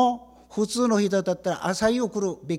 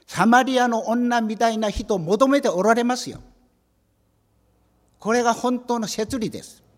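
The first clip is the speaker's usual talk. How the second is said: fervent preaching; 4 semitones higher, 6 dB louder than usual. Real, not acted. Preaching at full force.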